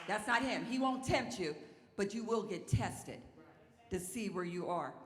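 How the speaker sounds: emphatic preacher's warning; forceful admonishing. Real, not acted. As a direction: set them straight from the pulpit.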